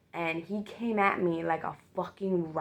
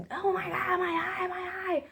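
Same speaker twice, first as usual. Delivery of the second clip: high pained shriek of a wounded man; mock agonised scream; 13 semitones higher than usual. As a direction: shriek it like he's been stabbed. Attacker screaming in pain.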